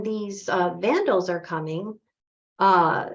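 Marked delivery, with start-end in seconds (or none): none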